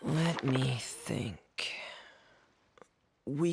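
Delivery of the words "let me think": slow, thoughtful delivery; slow musing; pondering. Acted. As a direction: draw the words out while thinking it over. Pondering before making a suggestion.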